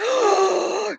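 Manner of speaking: big re-enacted gasp; sharp, high intake of surprise; shocked gasp. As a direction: gasp in mock astonishment. Retold shock at stolen toy.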